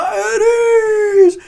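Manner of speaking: high, loud cracking yell, laughing through it; shrill mock bark of at ease. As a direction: bark the order in a high screech. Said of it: "she looks over and goes".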